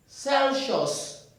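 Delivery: "Celsius" said as san-shos, wrong. Shown as a demonstration. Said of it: "we don't have anyone like".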